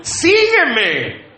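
high, emphatic call to the wife; mock husband's call. Comic replay of husband's call.

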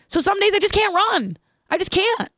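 high-pitched exasperated plea; frustrated. Real, not acted. Mom telling viewers about missing runs, high and exasperated. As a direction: let the frustration lift the voice.

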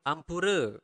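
ampura with a clearly rolled R. Shown as a demonstration. Teacher modelling Balinese sorry for learners, clear R. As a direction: pronounce the R in AMPURA distinctly. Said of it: "you need to pronounce the R clearly".

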